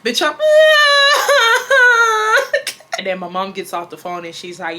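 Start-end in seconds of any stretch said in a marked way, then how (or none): 0.4-2.9 loud, high-pitched crying wail; long hammed-up sob, rising; mock bawling. Daughter hearing mom unenroll her, exaggerated bawling. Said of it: "bitch, I'm crying even more".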